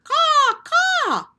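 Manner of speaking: very high, loud harsh caw; loud cawing crow; crow's warning caw. Acted. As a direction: sound the alarm like a crow. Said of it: "I didn't care for that voice".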